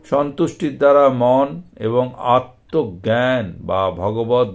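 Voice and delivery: measured, recitative storytelling read; scripture reading. A narrator's reading. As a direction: recite the Bhagavatam passage with devotion. Scripture reading after plain intro. (ভক্তিভরে শাস্ত্রপাঠ)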